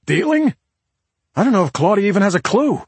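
high, loud echo then rushed scoff; incredulous. Acted. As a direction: throw the word back in disbelief. Husband incredulous at detective's question.

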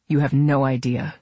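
plain reading voice

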